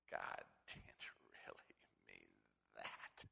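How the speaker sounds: slow, low whisper of temptation; tempter's whisper. Acted. Preacher voicing the tempting voice in a slow whisper. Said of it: "You can hear a voice".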